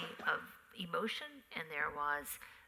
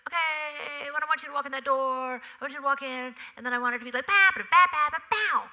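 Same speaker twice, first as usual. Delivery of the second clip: loud, high, sweeping directions; director giving notes; 8 semitones higher, 12 dB louder than usual. Retold or imitated. Director telling actors how to enter, loud and theatrical. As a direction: call it out big and musical, punching the sung syllables. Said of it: "So he might say".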